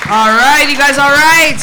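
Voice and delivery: big loud opening holler; shouted crowd greeting. Real, not acted. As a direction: shout out to the whole room. Comic greeting crowd on entrance.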